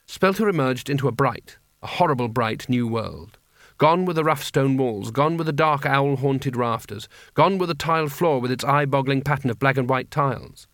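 usual speaking voice

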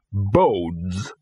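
one low ominous word; lightly voiced ominous dog. Dog pronounces the door's doom, low and ominous. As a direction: drop it like a doom omen.

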